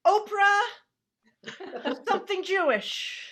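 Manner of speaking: loud, high mock-MC shout; hammed-up panicked introduction; blurted stage announcement. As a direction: call out a desperate wrong guess. Introducer blurts wrong name.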